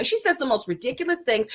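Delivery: normal talking voice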